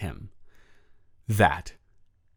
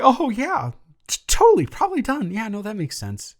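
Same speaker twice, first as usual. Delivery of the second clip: high, loud, fake-casual yeah-totally; forced breezy agreement; 9 semitones higher, 4 dB louder than usual. To Tony, hiding his shock: strained fake-casual agreement. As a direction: pretend you're fine with it, pitched way up.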